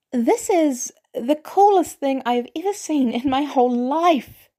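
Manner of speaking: put-on a teen boy gushing over the coolest thing ever; awestruck kid. A narrator's reading. Peter gushing to Barnes about his metal arm, awestruck.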